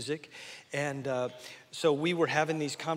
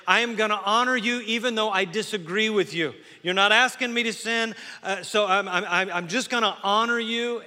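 loud, higher earnest vow; preaching; 5 semitones higher, 8 dB louder than usual. Acted. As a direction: proclaim it like a child's promise. Preaching children's vow to parents.

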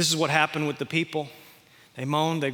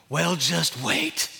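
1 grumbling pessimist; much slower than usual. Retold or imitated. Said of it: "somebody will say".

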